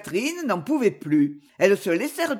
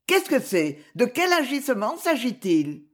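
ordinary delivery, nothing marked

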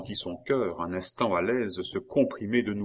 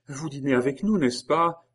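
higher, louder, eager invitation; radiant welcome; 7 semitones higher, 6 dB louder than usual. A narrator's reading. Geneviève asks Maurice to stay for dinner, radiant. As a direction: invite him happily, lifting the voice.

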